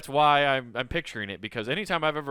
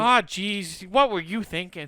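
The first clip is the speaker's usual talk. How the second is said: higher-pitched Midwestern "ah, jeez" voice; exasperated Midwesterner; 5 semitones higher, slower than usual. Acted. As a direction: chide them like a Midwesterner. Acting Midwesterner.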